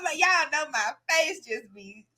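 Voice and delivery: ordinary talk, nothing marked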